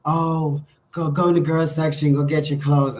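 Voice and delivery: light imitation of dad hurrying the kid along; dad's voice. Dad at the shop, sending the kid to the girls section, mildly mimicked and urgent. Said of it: "he'll be like".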